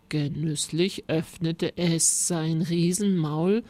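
plain reading voice

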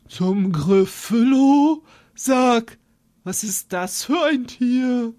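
lightly voiced owl, inviting; hooting owl. Owl inviting the mouse to tea, lightly voiced.

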